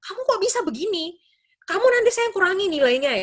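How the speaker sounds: high-pitched, scolding teacher impression; mock angry teacher. Teacher threatening a student who broke rules, mock angry. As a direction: act out a teacher telling off a student. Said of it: "langsung marah-marah".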